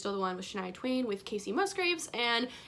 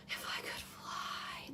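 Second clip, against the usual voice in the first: slow, breathy whisper of a song title; whispered with reverence; hushed whisper; 12 dB quieter, much slower than usual. Acted. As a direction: draw it out in a soft whisper.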